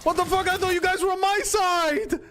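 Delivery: high startled outrage; shocked outcry. Real, not acted. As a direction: cry out, stunned the guards turned on you. Betrayed by guards in game.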